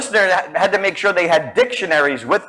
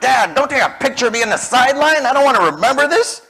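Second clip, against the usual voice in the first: put-on whiny teen; 9 semitones higher, faster than usual. Boy begging his dad not to photograph him, high whine. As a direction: complain like an embarrassed boy. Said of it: "I was like".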